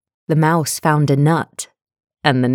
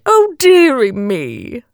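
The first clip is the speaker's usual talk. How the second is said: high, slow storybook lament; hammed-up royal woe; mock sobbing; 8 semitones higher than usual. A narrator's reading. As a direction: wail it slowly like a sorry king. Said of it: "The King sobbed".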